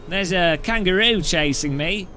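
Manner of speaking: mock a bad Australian caricature; cartoon Australian accent, overdone and cheerful. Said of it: "my Australian accent's not very good".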